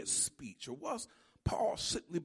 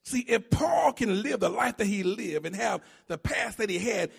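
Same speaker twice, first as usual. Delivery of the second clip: ordinary talk, nothing marked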